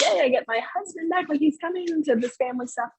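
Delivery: high-pitched retelling of an excited cheer; super excited. Retold or imitated. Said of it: "I was just super excited".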